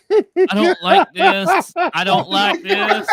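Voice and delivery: very high, slow squeaky complaint; squeaky legs voice. Pretend. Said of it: "my legs were like".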